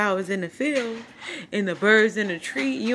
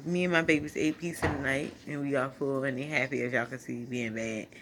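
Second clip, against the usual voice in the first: normal talking voice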